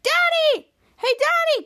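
high eager calling to dad; excited child. Retold or imitated. Young Ray greeting his dad, high eager call. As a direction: call out to dad like a happy kid.

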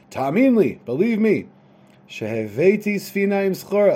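loud, high-pitched insistent protest; yelling. Acted. Son protesting to his father, yelling. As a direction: raise your voice, demanding to be believed. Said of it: "he yells and he says".

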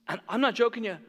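ordinary talk

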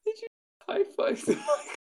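high squeal through helpless laughter; laughing. Real, not acted. Asking co-host about high-fiving mic, cracking up. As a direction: barely get the question out for laughing.

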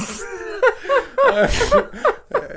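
laughing hard, high; high squealing laugh. Real, not acted. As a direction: burst out in a squeal. Big laugh at joke.

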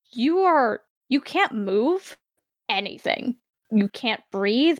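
ordinary talk

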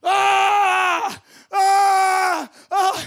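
loud panicked yells of ah; stuck-in-mud yelling. Retold or imitated. Buddy stuck to the waist, loud panicked ah cries. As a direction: yell ah as if sinking in mud. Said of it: "going like this".

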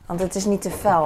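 ordinary delivery

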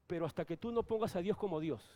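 ordinary talk

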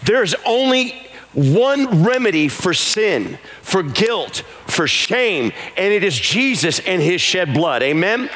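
high, slow, sung preaching over music; rising revival preacher chant; full-force preaching. Acted. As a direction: lift the congregation into a sung plea. Preaching builds into sung appeal.

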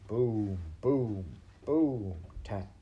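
three falling booms and a tap; drum imitation. A narrator's reading. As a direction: voice the steady beat of a drum. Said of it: "went the drum".

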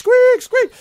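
very high, loud rat squeaks; squeaking rats. Acted. Frightened rats squeaking in alarm. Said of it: "The rats are like".

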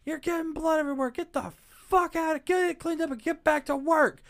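mock the boss barking orders; a shrill cartoonish boss; angry boss. Boss yelling at Randy.

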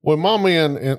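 failed Australian that comes out Southern; high-pitched Southern accent. Acted. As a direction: aim for Australian, land in Georgia. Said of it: "You're doing Southern".

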